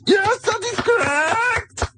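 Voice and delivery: put-on high, slow hammy German accent; over the top war film German; war movie German accent. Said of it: "doing the Nazi accent very well".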